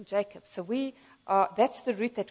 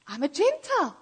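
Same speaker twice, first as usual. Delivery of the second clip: higher-pitched delighted announcement; retold excitement; 7 semitones higher than usual. Friend proudly telling Christians his revelation, retold excitement. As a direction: announce it like wonderful news. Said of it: "I was so excited".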